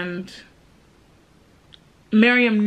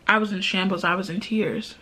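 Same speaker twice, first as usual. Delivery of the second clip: normal talking voice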